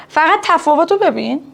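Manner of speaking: ordinary talk, nothing marked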